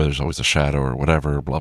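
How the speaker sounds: ordinary talk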